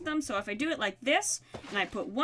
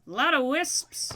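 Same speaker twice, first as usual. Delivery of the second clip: ordinary talk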